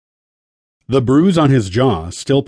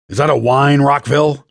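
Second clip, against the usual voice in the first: raised, challenging boss voice; stern rebuke; 4 semitones higher, 4 dB louder than usual. A narrator's reading. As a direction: pull the recruit up sharply. Boss scolding sulky recruit.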